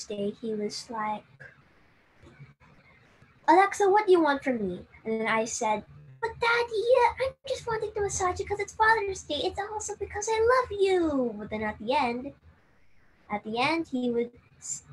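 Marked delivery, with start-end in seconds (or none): 3.5-4.8 quick, louder, higher demand; suspicious dad. Retold or imitated. Dad asking his daughter what she wants, suspicious and quick. 6.2-11.4 high, fast innocent pleading; sweet-talking daughter. Retold or imitated. Daughter reassuring dad on Father's Day, high sweet plea.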